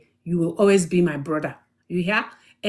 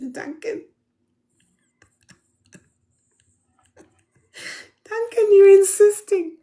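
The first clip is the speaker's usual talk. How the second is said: laughing; 9 semitones higher, 4 dB louder than usual. Real, not acted. Laughing at viewer's comment.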